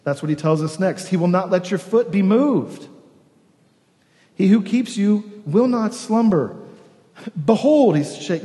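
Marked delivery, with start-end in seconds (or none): 1.1-6.6 scripture reading. Acted. Preacher reads the psalm aloud. 7.4-8.0 proclaiming. Acted. Preacher proclaims the psalm.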